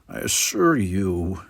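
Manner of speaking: low, slow and icy; coldly. Acted. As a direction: hold back the temper, cold and measured. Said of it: "he said coldly".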